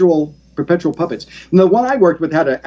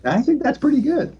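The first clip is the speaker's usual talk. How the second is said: puppet voice; 12 semitones higher, 3 dB quieter than usual. Acted. Puppet Mortimer speaks.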